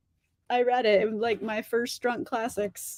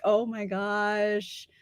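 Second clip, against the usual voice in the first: exasperated drawn-out sigh; 2 semitones lower, 7 dB quieter, much slower than usual. Retold or imitated. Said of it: "And I'm like".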